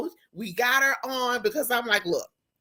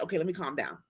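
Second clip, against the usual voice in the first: quieter; 6 semitones lower, 8 dB quieter, much faster than usual. Real, not acted.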